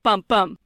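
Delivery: "bum, bum" with both hit hard; loud, equal weight on each "bum"; two stressed beats. Shown as a demonstration. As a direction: spondee beat, strong-strong on "bum, bum". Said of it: "two stressed".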